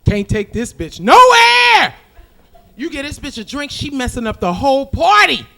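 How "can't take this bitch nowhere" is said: high, loud, slow shout; exasperated shout. Real, not acted. Comic complaining about the drunk friend, shouted high and slow. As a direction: holler it in disbelief.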